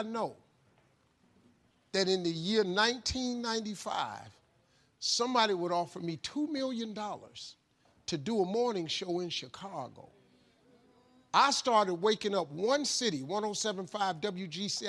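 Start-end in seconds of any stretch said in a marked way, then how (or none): none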